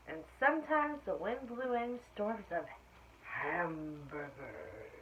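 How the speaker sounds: clear, lively storybook reading; reading to children. A narrator's reading. Teacher reading aloud to her class, storybook voice. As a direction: read it out to the class like story time. Reads picture book to class.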